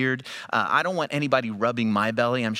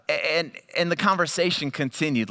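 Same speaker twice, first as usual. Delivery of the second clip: ordinary talk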